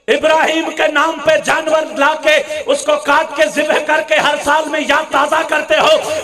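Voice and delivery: normal talking voice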